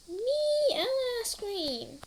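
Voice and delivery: put-on high, slow, childish eager cry; overexcited child calling out for ice cream; eager kid voice. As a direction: jump in like a little kid begging.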